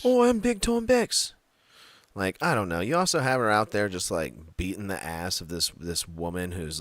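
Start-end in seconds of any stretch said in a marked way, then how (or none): 0.0-1.3 much higher, louder cartoon voice; Big Time Bex voice. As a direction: squeal it like a bobbing cartoon head. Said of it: "she's like".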